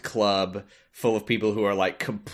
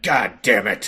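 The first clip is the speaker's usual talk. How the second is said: mock frustrated curse; 5 dB louder, slower than usual. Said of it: "council leader's like".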